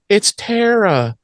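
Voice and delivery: mock-labouring, high drawn-out wail; imitating someone painfully slow to get words out; laboured slow speech. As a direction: stretch every word out in a high, struggling voice. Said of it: "it takes Willow three and a half hours".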